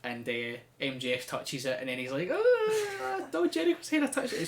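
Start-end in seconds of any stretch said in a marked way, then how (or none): 2.3-4.4 high-pitched fanboy squeal; giddy fan. Retold or imitated. MJF after touching Jericho's hair, giddy. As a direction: gush giddily, pitched way up. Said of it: "gets all giddy about it like a fan".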